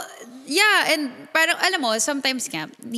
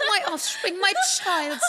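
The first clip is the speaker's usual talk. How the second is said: higher, giggly mock British; British accent; 4 semitones higher than usual. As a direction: savour it in playful British English. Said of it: "pero British accent siya".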